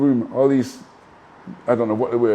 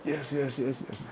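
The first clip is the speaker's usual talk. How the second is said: hushed awed yeses; quiet yes; 3 semitones higher, 12 dB quieter than usual. Retold or imitated. Nodding along hushed in the star's suite. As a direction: murmur yes under your breath.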